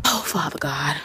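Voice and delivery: breathy whispered prayer; whispering. Real, not acted. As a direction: whisper it under your breath. Desperate prayer on icy steps.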